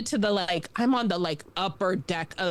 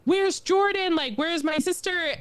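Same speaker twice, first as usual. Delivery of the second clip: high, put-on kid questioning; mock worried child; 8 semitones higher than usual. Child asking parents about missing sister, high mock worry. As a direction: act out a kid searching for her sister.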